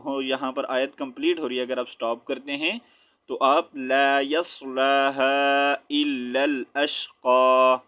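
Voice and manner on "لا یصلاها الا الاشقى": slow recitation of لا یصلاها الا الاشقى, long vowels, final قى held open; stop at verse end. Shown as a demonstration. Teacher showing students how to stop at the ayat's end, drawn-out recitation. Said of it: "ایسے وقف کریں گے".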